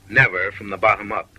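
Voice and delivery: normal talking voice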